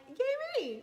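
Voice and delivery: high, loud little cheer; excited cheer. Retold or imitated. Wife cheering her own small victory, high and loud. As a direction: celebrate yourself with a squeal. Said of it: "I'm like".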